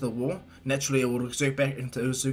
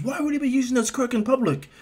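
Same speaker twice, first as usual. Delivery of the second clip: put-on high, alarmed boy voice; Izuku; 10 semitones higher, 3 dB louder than usual. Boy worried about his friend's recklessness, high alarm. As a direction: ask it in high-pitched disbelief. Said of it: "he goes".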